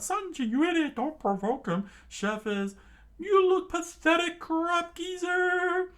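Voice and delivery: very high, loud screaming crew. Acted. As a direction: yell at him in panic. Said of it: "the chef's crew immediately screamed out".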